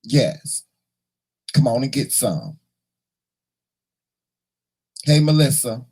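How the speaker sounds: normal talking voice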